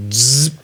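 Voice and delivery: imitated short-circuit buzz and pop; buzzing overload noise. Shown as a demonstration. Imitating overload going off inside.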